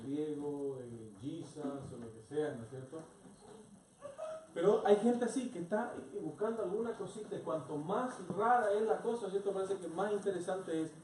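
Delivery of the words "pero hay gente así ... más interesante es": loud, high, driving sermon delivery; fervent preaching. Acted. Pastor rousing the congregation to preach, loud and fervent. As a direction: build it up like a pulpit call to mission.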